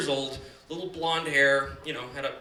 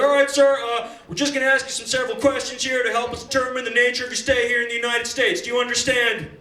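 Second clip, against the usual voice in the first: loud, higher formal officer voice; interrogating officer; 6 semitones higher, 5 dB louder than usual. Retold or imitated. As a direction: read the rights like a stern customs agent. Officer interrogating twelve-year-old.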